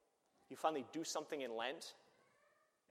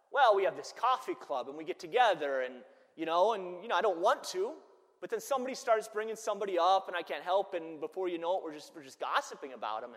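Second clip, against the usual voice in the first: chatty excuse; 6 semitones higher, 12 dB louder than usual. Retold or imitated. Grandparent explains the coffee club.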